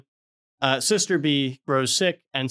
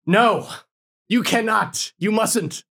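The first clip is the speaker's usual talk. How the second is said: higher, grand theatrical lament; melodramatic confession; 3 semitones higher than usual. Retold or imitated. Brother A stopping the husband, hammed-up grand lament. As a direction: declaim it like a stage tragedy. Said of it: "he goes".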